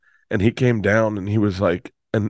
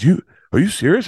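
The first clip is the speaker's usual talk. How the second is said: stunned dad; 8 semitones higher than usual. Retold or imitated.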